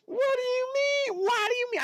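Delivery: high squeaky mock question; silly falsetto reading of a chat message. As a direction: pitch way up like a cartoon.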